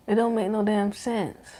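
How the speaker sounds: usual speaking voice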